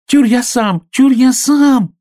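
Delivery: high, loud, eager squeal; high-pitched shout, stretched at the end; piglet shouting. A narrator's reading. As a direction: yell it as an excited little piglet. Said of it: "закричал поросёнок".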